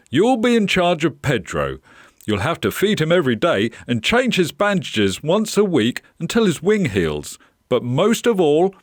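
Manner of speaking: put-on brief a young helper keenly; projected, encouraging instructions; enthusiastic owner. Voices shop owner in story.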